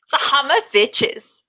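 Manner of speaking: high, hammed-up German accent. Shown as a demonstration. Host showing co-host her best German accent, comic German accent. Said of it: "your best German accent of saying Hammer of Witches".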